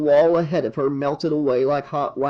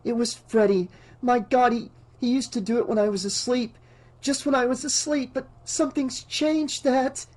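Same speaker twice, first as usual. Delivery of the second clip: high, hushed, panicky rush; frightened, high-pitched urgency under the breath; urgent hiss; 9 semitones higher than usual. A narrator's reading. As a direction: hiss it in rising alarm, stumbling at first. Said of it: "sat bolt upright and hissed".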